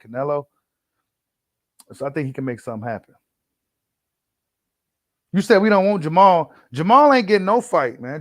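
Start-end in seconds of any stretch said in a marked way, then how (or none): 5.3-8.0 hyped up. Real, not acted. Hyped reaction to viewer comment.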